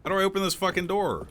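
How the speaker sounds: high, loud, exasperated question; exasperated yell. Real, not acted. Gamer stuck at a door, exasperated high yell.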